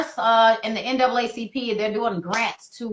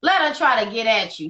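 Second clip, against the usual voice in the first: ordinary delivery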